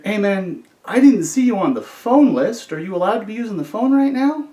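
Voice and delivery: questioning officer. Retold or imitated. Officer confronting inmate about the phone, questioning.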